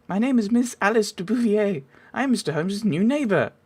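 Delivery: put-on ladylike voice, well above own pitch; lady's accent. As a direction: introduce yourself as a genteel neighbour. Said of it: "I keep changing your accent".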